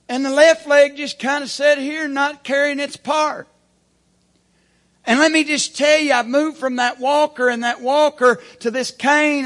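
loud, high preaching, building to a roar; full-force preaching. Acted. Pastor rousing his church, loud preaching.

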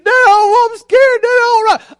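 put-on high, loud panicky kid wail; imitated scared boy. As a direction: wail like a frightened child, hammed-up. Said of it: "He's scared".

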